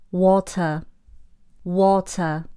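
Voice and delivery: slow British "water", no final R; British pronunciation. Shown as a demonstration. Teacher models a word for would-be Brits, British accent. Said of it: "让你读完三分钟后变英国人".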